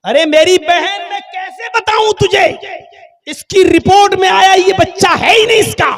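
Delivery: high, loud exasperation; doctor, fed up, shouting at the stubborn woman; exasperated shout. Acted. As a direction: yell it like you're at your wits' end. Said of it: "सर पकड़ के कहते".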